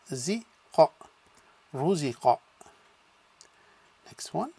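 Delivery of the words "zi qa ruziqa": "zi, qa" said apart, then joined as "ruziqa"; syllable by syllable. Shown as a demonstration. Teacher sounding out a word for students. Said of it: "the first one is ru".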